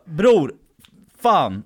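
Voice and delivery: loud mock scolding; exasperated outburst. Friend scolding drunk at the keypad, loud exasperation. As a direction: burst out at him in frustration.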